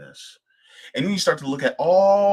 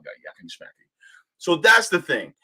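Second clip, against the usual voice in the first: usual speaking voice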